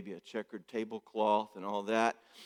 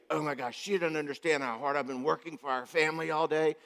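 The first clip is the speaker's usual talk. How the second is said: put-on wounded little boy; 4 semitones higher than usual. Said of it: "And he's like".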